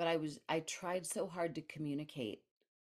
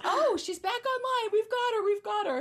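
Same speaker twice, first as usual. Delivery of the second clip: much higher and louder, excited retold cry; mock relief; 11 dB louder than usual. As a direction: announce the win like relieved medics. Paramedics relieved she revived.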